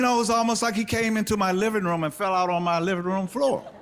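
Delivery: telling the bushes story, building to a high, loud pitch; rising emotion. Real, not acted. Recovery speaker recalling his lowest moment, voice rising high. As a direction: voice climbing high and loud as the memory hits.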